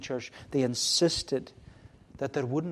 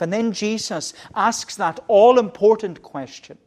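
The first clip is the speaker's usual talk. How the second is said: loud, driving sermon delivery, louder throughout; preaching at full force; 4 semitones higher, 9 dB louder than usual. Acted. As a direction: preach it out to the congregation. Preacher builds again.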